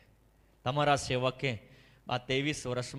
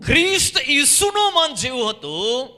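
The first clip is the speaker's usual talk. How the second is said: much higher, far louder echo of the reader; preaching at full force; 10 semitones higher, 12 dB louder than usual. Real, not acted. Preacher echoing the reader's verse, at full force. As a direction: thunder the verse back to the hall.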